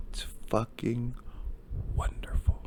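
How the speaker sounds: very soft, dropping to unvoiced; hushed awe. Real, not acted. Host praising nominees' ambition at rant's end, hushed. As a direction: let it sink almost to a whisper.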